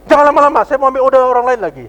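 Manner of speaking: a waiter who can't wait, pressing loudly; rude impatient waiter. Retold or imitated. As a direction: bark at the customer to be quick. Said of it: "ini orang ganas betul ini".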